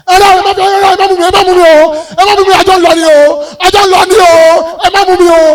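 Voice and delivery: loud high tearful wailing; hammed-up graveside sobbing; wailing cry. Mourner begging to die too.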